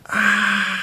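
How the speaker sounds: high brief cry standing in for the ego's scream; mock scream of pain. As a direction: imitate the ego's pained yell lightly. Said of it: "y grita".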